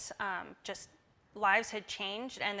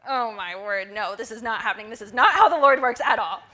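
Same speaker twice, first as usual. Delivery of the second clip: disbelief; 13 dB louder than usual. Retold or imitated. Refusing the news in disbelief.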